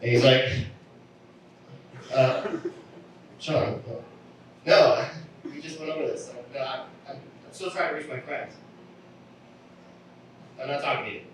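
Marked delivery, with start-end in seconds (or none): 2.1-11.2 higher-pitched, halting explanation; exasperated stranger. Acted. Same stranger, now exasperated, acted.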